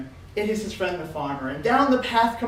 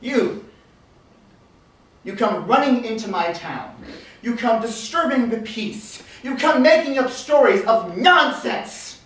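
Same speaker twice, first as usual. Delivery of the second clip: stern dressing-down; 6 dB louder than usual. Acted. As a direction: lay down the law, pausing between threats. Chief scolds and threatens them.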